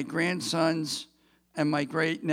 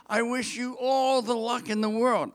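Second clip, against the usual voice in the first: mocking gush; 6 semitones higher than usual. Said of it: "such a vacuous wish".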